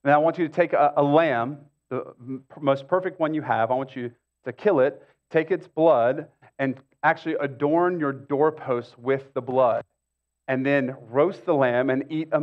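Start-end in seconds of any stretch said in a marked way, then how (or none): none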